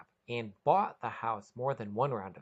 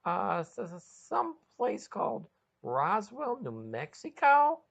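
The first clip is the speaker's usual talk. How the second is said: high, halting stammer rising to a louder ending; stuttering question; 9 semitones higher, 5 dB louder than usual. Acted. Sean stammering to his girlfriend where he is. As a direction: trip over the first words, unsure. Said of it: "questioningly stuttered".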